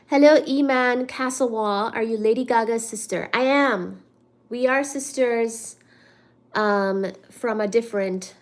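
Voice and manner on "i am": ordinary delivery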